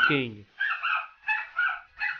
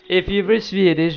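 louder Swedish-accent demo, slowing at the end; mock Swedish accent; 3 semitones higher, 4 dB louder than usual. Shown as a demonstration. Challenging a player who claims Sweden, mock Swedish accent. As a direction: show off a put-on Swedish accent. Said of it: "you would talk like this".

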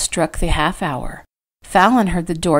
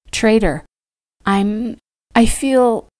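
hesitant, anxious plea; 5 semitones higher, slower than usual. Acted. Wife struggling to voice her fear.

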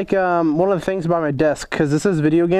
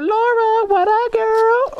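put-on much higher, slow sing-song girly voice; mock girly voice; much slower than usual. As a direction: swoon over the name like a teen girl. Mocking girly voice for "Laura.

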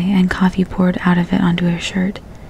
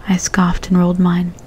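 usual speaking voice

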